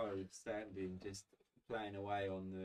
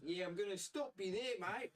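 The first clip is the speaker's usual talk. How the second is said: higher, deadpan mate voice; blunt bloke voice; 12 semitones higher than usual. Acted. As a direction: shut the kid down, bluntly. GM voices Harold.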